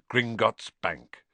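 ordinary delivery, nothing marked

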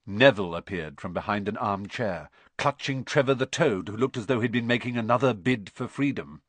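ordinary delivery

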